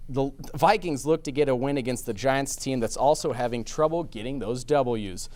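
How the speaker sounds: normal talking voice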